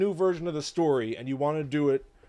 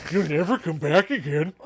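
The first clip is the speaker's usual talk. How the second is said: slow, loud, much higher doom-laden warning; slow ominous raspy drawl; 6 semitones higher, 9 dB louder than usual. Retold or imitated. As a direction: proclaim the curse like a mad prophet. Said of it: "Where's Crazy Ralph?".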